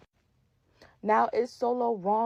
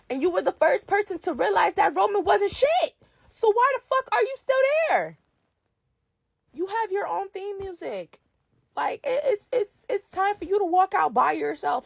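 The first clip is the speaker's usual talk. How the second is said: near tears pleading; 8 semitones higher than usual. Real, not acted. Pleading with Jimmy, voice cracking.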